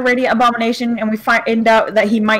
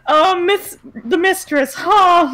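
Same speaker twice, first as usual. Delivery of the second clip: imitated reaction; 6 semitones higher, much slower than usual. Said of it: "most of you were like".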